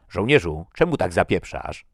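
loud military snap; barked question. A narrator's reading. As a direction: bark it like an officer challenging his man. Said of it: "warknął Svensson".

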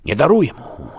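high, loud snarl; loud, high outburst of anger; angry snarl. A narrator's reading. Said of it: "warknął Tom".

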